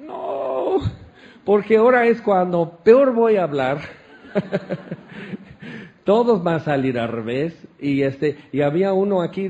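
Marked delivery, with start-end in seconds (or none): 0.1-6.0 laughing. Retold or imitated.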